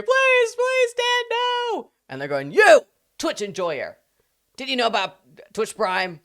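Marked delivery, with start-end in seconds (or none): 0.0-1.9 very high, loud mock-terrified wail; hammed-up pleading. Acts victims pleading at gunpoint. 2.5-6.2 loud, high hammed-up call-out; playful raised-voice demand; mock confronting shout. Acts gunmen addressing a viewer.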